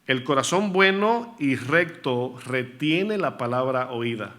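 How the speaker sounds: slow, deliberate quoting of the verse with "retiene" leaned on; careful slow reread. Shown as a demonstration. Preacher corrects his misquote to the church, slowly and deliberately.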